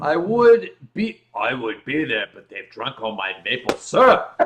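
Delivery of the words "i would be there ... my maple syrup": much lower, put-on voice; mock Canadian voice. Host jokes about maple syrup in a low put-on voice.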